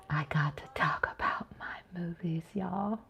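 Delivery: breathy whisper to the viewers; soft whispered confession; whisper aside. Pretend. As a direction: lean in and whisper the secret.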